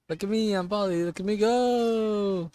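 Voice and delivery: high, loud show-off voice; mock a friend's attention-seeking, stretching the last words long; Polly impression. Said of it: "I do not talk like that".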